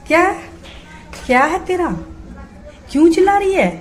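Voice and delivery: loud, high, sharp scolding; scolding the parrot. Real, not acted. Owner scolds her screeching parrot, loud high scolding. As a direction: tell off a noisy pet bird.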